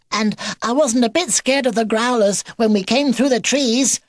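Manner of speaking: high, squeaky, boastful little voice; proud baby beaver. A narrator's reading. Baby beaver telling grandpa he was brave, squeaky pride. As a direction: pipe it up proudly in one long boast. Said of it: "added Baby B proudly".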